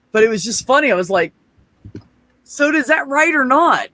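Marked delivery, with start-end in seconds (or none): 2.4-3.9 high, loud, drawn-out question; slow it down and demand an answer, half laughing; exasperated demand. Retold or imitated. Learner demanding a verdict on pronunciation, comically exasperated.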